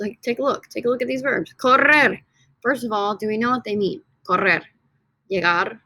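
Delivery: ordinary talk, nothing marked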